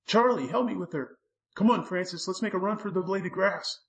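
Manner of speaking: high, frantic call for help; urgent shout. A narrator's reading. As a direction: yell to your friends as you run. Boy shouting to animals while fleeing.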